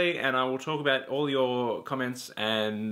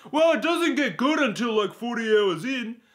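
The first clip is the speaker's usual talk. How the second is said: high, louder whiny mockery of a fan; mocking commenter; 7 semitones higher, 5 dB louder than usual. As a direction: sneer out the comment in a higher silly voice. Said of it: "this is, like, a top comment".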